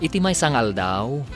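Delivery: ordinary talk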